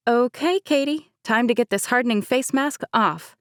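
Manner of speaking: firm. A narrator's reading. Chloe firmly tells Katie to remove the bride's mask. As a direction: take charge kindly. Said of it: "Chloe said firmly".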